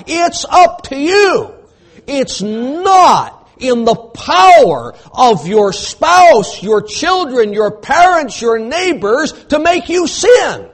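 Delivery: high, loud shouted preaching; rising fiery sermon shout; preaching at full force. Acted. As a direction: thunder it at the congregation.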